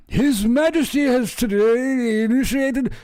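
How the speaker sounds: high, slow pompous proclamation voice; mock royal announcement. Comedian mocking the palace statement, pompous herald voice. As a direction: read it like a stuffy royal herald.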